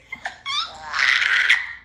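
very high squealing laughter; shrieking laugh. Real, not acted. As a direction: burst into a helpless squeal of laughter. Laughing fit peaks.

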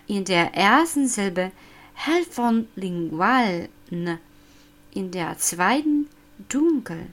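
ordinary delivery

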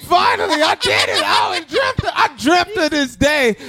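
loud proud boast; triumphant. Acted. Boasting to friends about finally knocking someone out, loud triumph. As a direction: crow about it like a long-awaited win.

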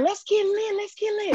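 high, bouncing cheer of a new winner; excited cheer. Retold or imitated. Athlete retelling calling her coach after winning, excited cheer. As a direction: shout it up like you just won. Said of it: "And I'm excited".

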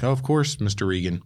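hammed-up fawning butler tone; mock servile bartender. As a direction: lay the politeness on thick. Norman voiced, mock servile.

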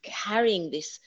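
ordinary delivery

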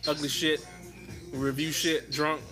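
normal talking voice

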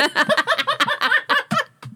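a shrieking laugh way up high. Real, not acted. Host cracking up at her own parody, high laugh. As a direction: let a high giggle fly.

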